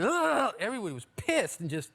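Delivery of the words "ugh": mock drunk groan. Drunk bandmate answering.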